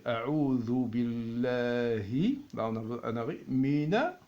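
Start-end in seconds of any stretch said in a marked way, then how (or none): 0.0-2.4 "A'udhu billahi" drawn out, ain in the throat; slow recitation. Shown as a demonstration. Teacher to students, "A'udhu billahi" slow and drawn out. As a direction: stretch "A'udhu billahi" slowly.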